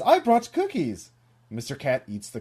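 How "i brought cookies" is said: high, loud, lightly acted nervous offering; hammed-up timid critter, pitched high; scared cartoon fox. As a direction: Missus Fox piping up in mock fear. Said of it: "seems very scared".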